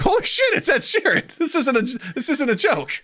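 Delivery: high-pitched squealed disbelief; imitated amazement, breaking into laughter; astonished audience. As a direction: squeal it like a stunned fan.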